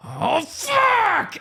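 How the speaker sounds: very high-pitched acted yell of rage; angry outburst. Refused stranger kicks a car, acted furious yell. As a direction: explode as the imitated stranger losing it. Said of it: "He was, like, so angry".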